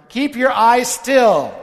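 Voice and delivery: put-on high, loud, slow little-kid order; mock toddler scolding dad; bossy four-year-old. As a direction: play the stern tiny makeup artist. Four-year-olds doing his makeup.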